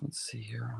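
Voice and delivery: whispered aside to himself; whispering. Real, not acted. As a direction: breathe it under your breath. Whispering to himself mid-stream.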